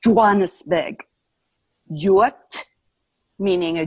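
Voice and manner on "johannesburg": Johannesburg in an African accent, squeezed to Jwah-nis-beg; African-accented Johannesburg, no R at the end. Shown as a demonstration. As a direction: say Johannesburg as an African speaker would. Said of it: "or with an African accent".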